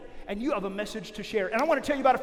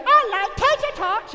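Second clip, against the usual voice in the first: high, slow, louder put-on lunch lady voice; happy lunch lady; 10 semitones higher, 7 dB louder than usual. Lunch lady loves tater tots, high beaming voice. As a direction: beam with delight over the tater tots. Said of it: "she'd be like".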